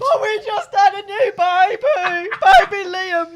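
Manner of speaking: loud, high fake wail; loud crying shout. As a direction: bawl it out loud. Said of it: "don't do it so loud".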